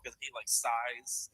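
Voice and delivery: ordinary talk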